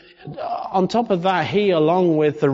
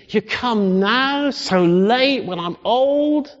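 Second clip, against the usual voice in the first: put-on grumbling old man; 5 semitones higher than usual. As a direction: whine at God about lateness. Voicing Zechariah's complaint to God.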